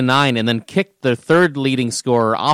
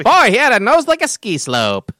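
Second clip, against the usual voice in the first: put-on old-timey voice; 7 semitones higher than usual. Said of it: "we're back in old-timey voice".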